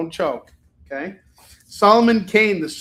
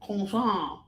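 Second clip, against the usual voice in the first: high Frenchified "croissant"; mocking French kwah-sahn; mock French accent; 4 semitones higher than usual. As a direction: sneer the word in affected French. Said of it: "pronounce everything like he's French".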